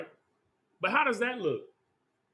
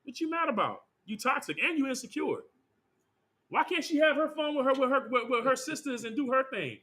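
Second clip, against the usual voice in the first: higher, indignant mock scolding; hammed-up voice of the culture mocking the king; mock outrage; 5 semitones higher, 3 dB louder than usual. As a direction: play the offended modern critic. Mocking modern critics of the king.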